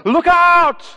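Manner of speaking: loud, high warning shout; sudden shout of alarm. Retold or imitated. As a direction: yell it like someone's in danger.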